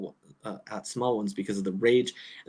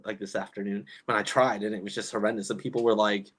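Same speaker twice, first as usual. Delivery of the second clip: ordinary talk, nothing marked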